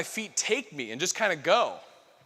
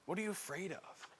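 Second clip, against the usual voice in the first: much softer, almost breathed; near-whispered question; 13 dB quieter than usual. Real, not acted. Preacher asking retreat group, barely above a breath. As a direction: ask it gently into the silence.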